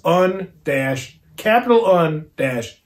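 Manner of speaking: ordinary delivery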